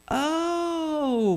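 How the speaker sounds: long, high drawn-out "oh"; acted slow "ohhh" of understanding; dawning realization. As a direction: stretch it out as the penny slowly drops.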